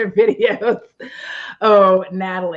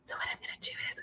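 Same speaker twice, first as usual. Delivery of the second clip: mock ASMR whisper; 21 dB quieter, much faster than usual.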